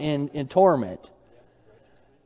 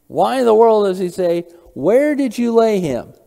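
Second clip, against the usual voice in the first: raised, louder rhetorical question; emphatic puzzlement; 5 semitones higher, 5 dB louder than usual. Real, not acted. Preacher challenging the class over Jesus' question, emphatically puzzled. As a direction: press the question, pitched up in puzzlement.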